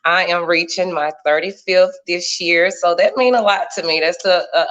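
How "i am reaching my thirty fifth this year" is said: usual speaking voice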